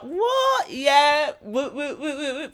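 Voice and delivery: imitating a thrilled kid, high-pitched whoops; hyped-up mock celebration; excited cheering. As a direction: whoop it up as the girl showing off. Acts out girl celebrating new outfit.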